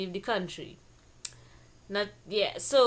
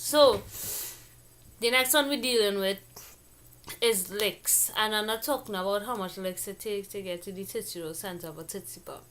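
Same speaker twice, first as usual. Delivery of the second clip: ordinary delivery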